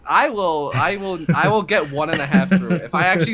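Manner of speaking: loud boast. Real, not acted. Racer jokes about saying the long sponsor name fast, loud boast. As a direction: blurt it out high and very loud.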